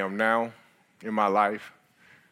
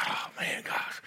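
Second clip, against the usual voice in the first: breathy self-talk; 6 dB quieter, slower than usual. Retold or imitated. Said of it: "I'm like".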